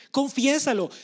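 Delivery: emphatic pulpit exhortation; forceful command. Acted. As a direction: drive the word home with force. Repeated call to confess.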